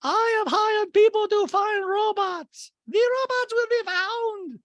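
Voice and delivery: intense, loud, slow falsetto; Diana. Retold or imitated. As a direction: proclaim it high and slow with mock intensity. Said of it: "just kind of intensity".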